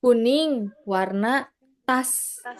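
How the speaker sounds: word by word, slowly; slow teaching demo of the reversed order; slow and deliberate. Shown as a demonstration. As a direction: lay out each word slowly for the student.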